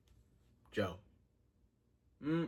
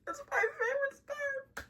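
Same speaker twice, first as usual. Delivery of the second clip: lady seller; slower than usual. Retold or imitated. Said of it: "She's like".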